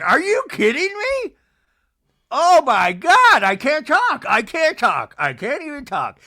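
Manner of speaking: high, loud mock exasperation; Meredith impression. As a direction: throw a hammed-up outraged fit.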